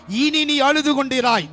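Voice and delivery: declared aloud. Shown as a demonstration. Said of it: "பக்கத்துல பார்த்து சொல்லுங்க".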